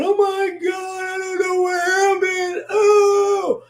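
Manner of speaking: high, slow, drawn-out cry of confusion; distressed exclaim. Real, not acted. Streamer opening the live, crying out in confusion. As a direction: wail it like you're lost and overwhelmed.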